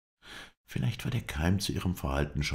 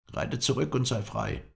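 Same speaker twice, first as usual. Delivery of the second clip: mildly raised, high-pitched command; loud call; 9 semitones higher than usual. A narrator's reading. Hadidin sends the captive riding off, calling out. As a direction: call out high, without a full shout. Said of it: "die laute Stimme des Hadedin".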